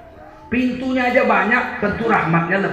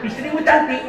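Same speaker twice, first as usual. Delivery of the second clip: Javanese way of speaking; 4 semitones higher than usual. Retold or imitated. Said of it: "Orang Jawa kalau bilang banyak ditambah u".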